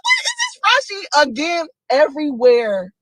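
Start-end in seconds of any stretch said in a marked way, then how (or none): 0.0-1.3 very high shocked squeal; mock surprise. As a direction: shriek it in disbelief. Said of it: "I'm like".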